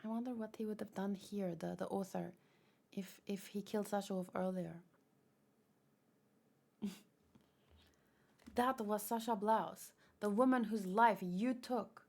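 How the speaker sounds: ordinary talk, nothing marked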